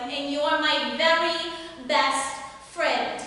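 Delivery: hammed-up New Jersey housewife accent, gossiping on the phone; chatty mob wife from New Jersey, a loosely done Jersey accent; a deliberately bad New Jersey accent with giggles. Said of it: "Are you also ready for a bad accent?".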